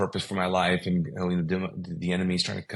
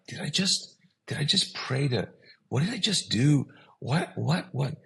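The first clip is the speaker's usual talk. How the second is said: high, stammering self-questioning; retold bewilderment, pitched way up; stunned disbelief; 7 semitones higher than usual. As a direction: question yourself in mock shock. Retelling stunned disbelief after praying.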